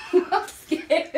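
nervous laugh breaking into it; laughing scared. Real, not acted. Nervous client laughing before her wax. Getting waxed, repeating nervously.

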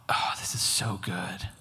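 awed whisper. Retold or imitated. Retelling entering the Holy of Holies, awed whisper. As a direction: breathe it out in hushed wonder.

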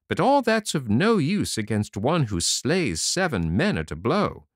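high, swaggering report; proud little tailor. A narrator's reading. Tailor boasting to the horsemen, high and proud. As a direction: show off the victory.

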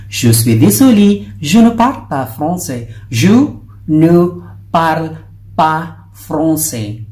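stressed désolé, slowing at the end; emphatic French sentence. Shown as a demonstration. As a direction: hit désolé, then slow down on pas français. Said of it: "Dinugtong mo lang yung désolé".

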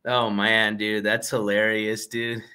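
ordinary talk, nothing marked

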